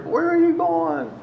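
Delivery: high-pitched, alarmed mom impression; imitated mom calling out. Mom in the car to her son leaving with friends, imitated high call.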